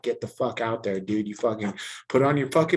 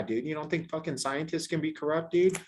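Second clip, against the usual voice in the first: ordinary delivery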